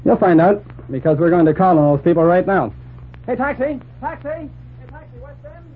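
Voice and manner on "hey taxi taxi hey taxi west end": hailing a taxi. Acted. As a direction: shout across the street for a taxi. Calling a cab on street.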